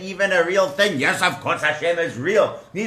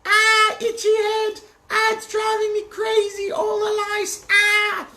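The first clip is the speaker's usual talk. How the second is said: high, wailing complaint about itchy lice. Acted. Egyptian tormented by lice, high wailing. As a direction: squeal as if the itch is unbearable.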